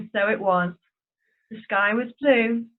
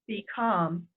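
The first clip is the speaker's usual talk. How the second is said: calm command; 3 semitones lower, 6 dB quieter than usual. Acted. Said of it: "very calmly".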